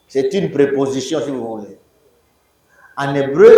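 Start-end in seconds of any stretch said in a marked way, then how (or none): none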